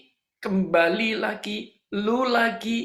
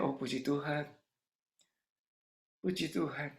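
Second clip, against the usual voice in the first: hushed aside, much softer; inner thought; 3 semitones lower, 10 dB quieter than usual. Retold or imitated. Preacher secretly thanks God at her news, hushed inner voice. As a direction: murmur it privately, as if only thinking it. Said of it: "Dalam hati saya".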